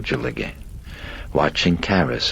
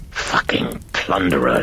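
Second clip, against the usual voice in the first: harsh rasped curse. Acted. Personality curses the priest recording it, a harsh rasp. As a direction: rasp the insult out. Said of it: "it rasped".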